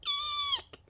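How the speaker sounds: very high startled squeak; high squeal. A narrator's reading. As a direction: shriek like a frightened piglet. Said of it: "squealed the little pig".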